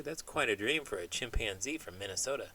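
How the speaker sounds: ordinary delivery, nothing marked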